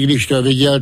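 plain reading voice

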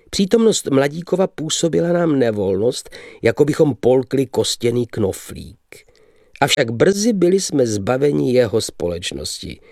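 ordinary delivery

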